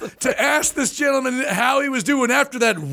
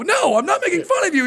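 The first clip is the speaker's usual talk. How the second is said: flustered denial; 8 semitones higher, faster than usual. Retold or imitated. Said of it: "Dave protests".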